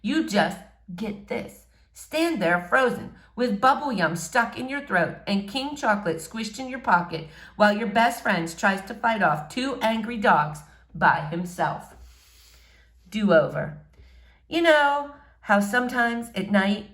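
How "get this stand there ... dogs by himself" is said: ordinary delivery, nothing marked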